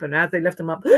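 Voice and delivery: usual speaking voice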